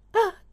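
high, loud startled cry; cry of fright. Acted. Omine hears Tomozo and cries out, high loud startled cry. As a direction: shriek in sudden fright. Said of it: "と声を上げる".

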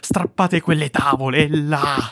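high, loud, slow desperate shout; screaming confession. A narrator's reading. Killer screams his confession to the officers. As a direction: break down and scream the truth.

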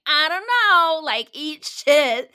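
loud, drawn-out mock indifference; dismissive mock imitation. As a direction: mimic a careless brush-off, slow and loud. Mimicking the unhelpful guy.

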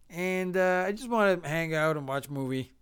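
ordinary talk, nothing marked

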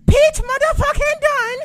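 very high, loud mock British yell; UK fan impression. Imitating UK fans saying the name, high British shriek. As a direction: screech it like a hyped British fan. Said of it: "That's how they say it".

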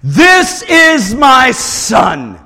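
slow, loud, booming divine shout; thundering voice. Retold or imitated. God speaking from the cloud, slow booming shout. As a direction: boom it down from the cloud. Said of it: "a voice thunders from that cloud".

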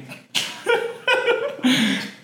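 very high squealing laugh; high giggling fit. Real, not acted. Friend about to mimic her date, high squealing giggles. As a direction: giggle helplessly before the impression. Cracking up before imitating him.

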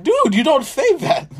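high, loud, drawn-out disbelief; shocked, stretching each word out; aghast at what she just said; incredulous dude. Retold or imitated. Shocked at cousin's remark.

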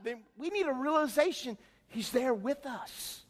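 ordinary delivery

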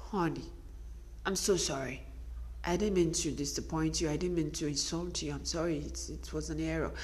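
acted soft, low apology; meek apology. Wife apologizing sweetly to her husband, meek and hushed. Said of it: "you just go".

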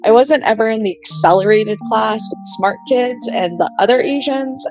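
usual speaking voice

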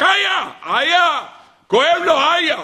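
mock howls of pain, very high; crying out. Preacher acts Job scratching his sores, crying out in pain. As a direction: yelp it out, drawn long. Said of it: "וצעק".

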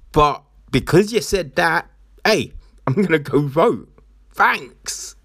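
sarcastic mock viewer. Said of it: "no one's gonna watch that and go".

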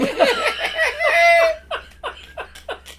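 long high belly laugh; high loud cackle. Real, not acted. Telling mate the story, big high laugh. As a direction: burst out laughing at the punchline.

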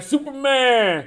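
high, stretched-out call; slow, high quoted cry; acted call-out. Acted call from film scene.

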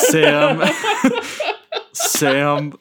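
laughing through the name. Real, not acted. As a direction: crack up while saying it. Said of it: "laughing at the kid".

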